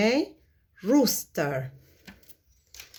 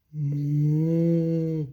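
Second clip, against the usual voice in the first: mooing like a cow; 6 semitones lower than usual. Acted. Cow sound for kids.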